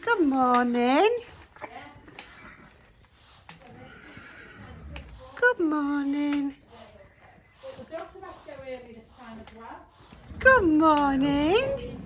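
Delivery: slow, high, drawn-out pet greeting; cooing to horses. Real, not acted. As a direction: stretch it out sweetly for the horses. Greeting each horse slowly.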